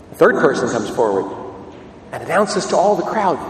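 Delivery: ordinary talk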